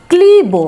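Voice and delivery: "ক্লিব" loud and high, long vowel; pronunciation demo. Shown as a demonstration. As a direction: model "ক্লিব" clearly for learners. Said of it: "উচ্চারণটা হলো ক্লিব".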